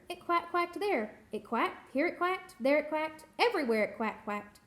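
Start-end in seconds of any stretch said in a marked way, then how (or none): none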